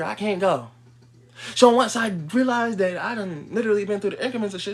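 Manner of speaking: ordinary talk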